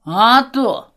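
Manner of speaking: loud, proud boast; proudly. Acted. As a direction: throw it out proudly. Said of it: "гордо вскидывает Пётр".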